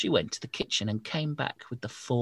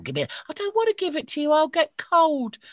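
put-on high, fast pleading old woman; whiny old lady begging to keep her shawl; 6 dB louder than usual.

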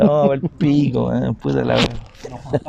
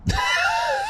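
high shrieking laugh; 8 dB quieter than usual. Real, not acted. Laughing at mom's quote.

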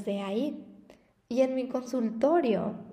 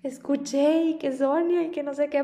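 higher and louder, breathless retold mom; retelling mom, excited; 4 semitones higher, 5 dB louder than usual. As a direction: relay mom's spooked excitement. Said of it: "pero atacada de la risa".

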